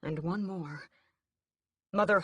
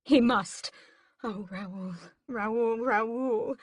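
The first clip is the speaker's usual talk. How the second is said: higher, breaking calls of his name; sobbing mother; 6 semitones higher, 4 dB louder, much slower than usual. A narrator's reading. Mother sobbing over dying son Raoul, mild sob. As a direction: plead through sobs. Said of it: "sobs against his chest".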